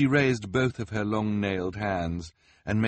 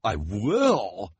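breathless; 4 semitones higher, 4 dB louder than usual. A narrator's reading. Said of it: "the reporter said breathlessly".

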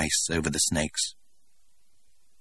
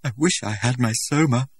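a lightly higher, rote repeat; mechanical; 6 semitones higher than usual. A narrator's reading. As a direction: say it by rote without feeling. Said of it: "said Lenina mechanically".

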